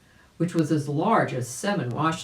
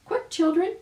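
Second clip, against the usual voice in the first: very high, louder urgent bird voice; mama bird urging; 4 dB louder than usual. A narrator's reading. Mama bird urges her chicks, very high and urgent. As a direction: hurry the little ones along. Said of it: "she cried".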